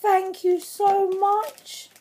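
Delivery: high, slow, drawn-out thanks; overjoyed. Real, not acted. Vlogger thanking her friend for Prima flowers, overjoyed. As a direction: stretch the thanks out with joy. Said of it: "shaking a little bit with excitement and".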